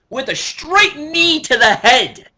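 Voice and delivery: loud, high commentator-like burst; excited shout. Real, not acted. Fan reliving the winning knee strike, excited shouting. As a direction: belt out the finishing move.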